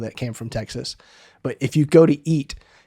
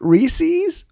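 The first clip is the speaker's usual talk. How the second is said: Reese's? squeaked high in disbelief; a high mocking echo of her word; mock incredulous question. Teasing a friend's pronunciation, high disbelieving echo. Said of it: "no, it's not the same word".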